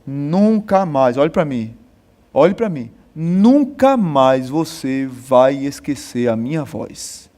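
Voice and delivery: low, slow, arrogant warning; arrogant captain. Retold or imitated. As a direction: lean down on the recruit, deep and slow. (capitão arrogante, voz grave e lenta)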